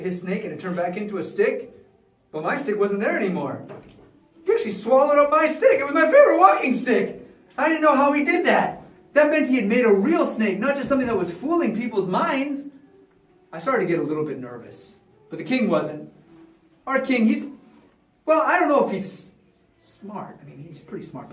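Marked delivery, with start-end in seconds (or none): 4.4-12.6 high, loud flustered protest; indignant disbelief. Acted. Magician lost his favorite stick to Moses, high indignation. 19.8-21.3 very soft, low aside; cautious hedge. Acted. Magician doubts his king's brains, hushed cautious aside. Hedging about his king.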